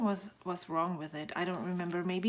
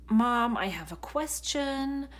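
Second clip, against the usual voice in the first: put-on higher, drawn-out kid calling for mom; pestering child; 4 semitones higher, 3 dB louder than usual. Mom imitates her children's constant questions, pestering. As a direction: imitate a child wanting attention.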